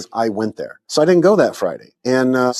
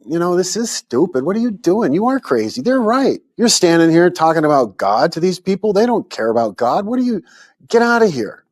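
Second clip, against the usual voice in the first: higher-pitched, exasperated mock scolding; retold self-scolding; 6 semitones higher than usual. Worn-down street preacher telling himself off, retold exasperation. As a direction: berate yourself in a retold inner voice, pitch jumping up.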